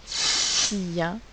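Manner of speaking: "sien" with a long hissed S, then the vowels; stretched-out sounds. Shown as a demonstration. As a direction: slowly blend the sounds of "sien". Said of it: "trois sons".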